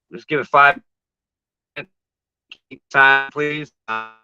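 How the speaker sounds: audio cutting out; broken-up bad connection; choppy cut off endings. Real, not acted. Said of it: "you're cutting out again".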